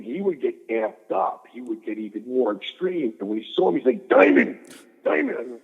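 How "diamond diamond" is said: shouted Diamond, much higher and louder; over-the-top Macho Man call; Randy Savage. Retold or imitated. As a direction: yell the name like an amped-up wrestler. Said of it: "he really talked like that".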